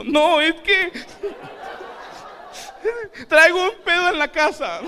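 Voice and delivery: very high, loud whiny crying voice, laughing; mock crying excuse. Comedian on the floor answering the gym, high whiny crying. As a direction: whine your excuse through fake tears. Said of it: "Y en el piso".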